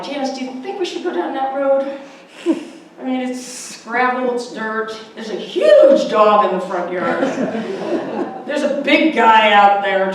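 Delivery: nervous, hesitant asking, laughing through it; half-laughing worry about the dog and the big guy; retold worried question. Campaigner asks her driver about the gravel road, mildly worried. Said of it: "I'm like".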